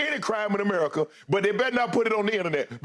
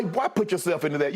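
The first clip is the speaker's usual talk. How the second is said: normal talking voice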